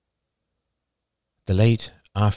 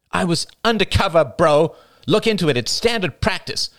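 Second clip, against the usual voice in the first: high, insistent self-defence; indignant, raised-pitch justification; defensive; 11 semitones higher, 3 dB louder than usual. A narrator's reading. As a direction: push back hard, pitched up. Rachel defends herself in the quarrel.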